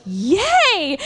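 high, drawn-out little cheer; acted cheer. Hearing that the neck is healed, high acted cheer. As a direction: cheer it high in retold delight. Acts own cheer at good news.

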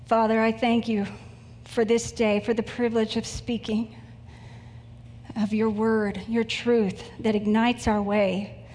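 earnest, measured prayer voice. Acted. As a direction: congregation prayer before the sermon, reverent and unhurried. Opens sermon with prayer.

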